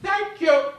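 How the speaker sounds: high, loud, icy diva thank-you; a grand exit line; Betty Davis. Retold or imitated. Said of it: "Betty Davis turned to the hostess and said".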